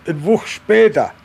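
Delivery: ordinary delivery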